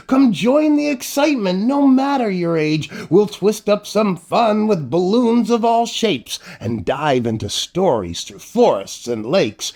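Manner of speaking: plain reading voice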